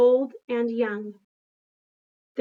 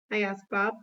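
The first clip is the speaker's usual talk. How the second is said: ordinary delivery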